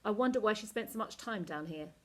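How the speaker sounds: ordinary delivery, nothing marked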